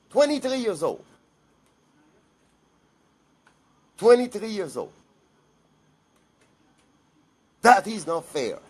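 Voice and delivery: high, loud cry of grief and rage; breaking into a raw, grieving shout; anguished outburst. Real, not acted. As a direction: let the pain burst out loud. Grieving uncle's outburst over murdered niece.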